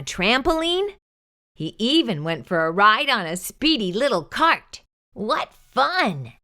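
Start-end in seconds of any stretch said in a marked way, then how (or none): none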